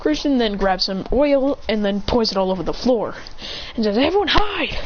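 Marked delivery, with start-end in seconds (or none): 4.0-4.8 high-pitched command to hide; urgent warning call. Acted. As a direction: alert the group, pitch jumping up. Character warns the group.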